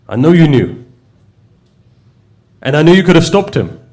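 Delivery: retold accusation, rising to high and loud; upset reproach. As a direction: reproach him loudly as the wronged pilgrim, pausing between blows. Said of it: "he gets upset".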